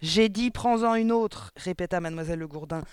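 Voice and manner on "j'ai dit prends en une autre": loud, higher and drawn out; stern order. A narrator's reading. Mademoiselle Le Gourdin orders the boy to take another slice, stern and loud. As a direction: order the child sternly, loudly.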